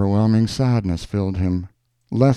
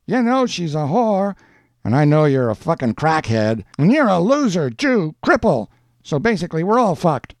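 high, raised insults; heated quarrel; 9 semitones higher, 4 dB louder than usual. A narrator's reading. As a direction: hurl abuse at a neighbour, pitched well up. Acted quarrel between the neighbours.

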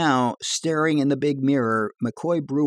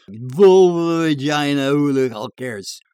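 dismissive; 3 semitones higher, 6 dB louder than usual. Acted. Edgar dismisses.

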